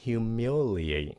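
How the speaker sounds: slow, drawn-out "Humiliate"; teacher modelling "Humiliate" slowly; pronunciation model. Shown as a demonstration. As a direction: stretch "Humiliate" so every syllable is heard. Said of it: "các bạn phát âm nha".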